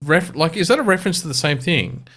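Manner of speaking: high, puzzled double take; surprised realization. Retold or imitated. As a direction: suddenly catch on, confused. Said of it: "I'm like".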